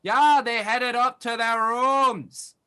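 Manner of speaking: big, slow, booming innkeeper; loud innkeeper voice. Acted. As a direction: play the jolly loud innkeeper.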